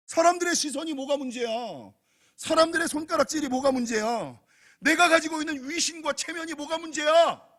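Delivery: loud, high defiant rhetorical questions; defiant pulpit cry; full-force preaching. Acted. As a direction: fire out each question at the congregation.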